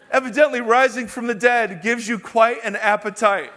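loud, high, soaring sermon voice; full-force preaching. Acted. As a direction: preach it out to the whole church.

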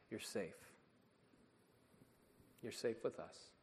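put-on soft, steady promise to a scared child; gently calm her fears; quiet fatherly reassurance; reassuring.

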